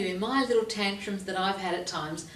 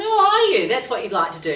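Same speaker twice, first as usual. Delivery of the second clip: much higher loud teasing oh; mock surprise; 6 semitones higher, 11 dB louder than usual. Therapist teasing grumpy client, high mock surprise. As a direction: feign amazement teasingly.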